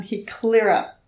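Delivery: ordinary talk, nothing marked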